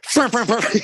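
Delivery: high, loud horn honks of fur; copying the co-host's horn demo, high and loud; horn impression. Shown as a demonstration. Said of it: "You gotta put the F in there".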